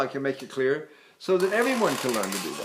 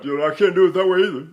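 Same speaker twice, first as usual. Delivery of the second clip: fast dark voice with pushed-down larynx; lowered larynx; 5 dB louder, faster than usual. Shown as a demonstration. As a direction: press the larynx down. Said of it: "I'm not lowering my larynx like that".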